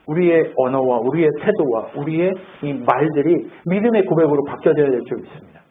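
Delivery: ordinary delivery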